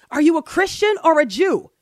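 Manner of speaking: second man voiced eager and loud; eager stranger. A narrator's reading. Voicing second man in joke.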